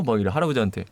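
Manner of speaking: ordinary talk, nothing marked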